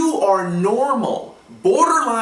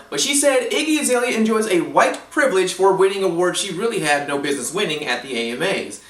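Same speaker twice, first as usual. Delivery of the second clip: normal talking voice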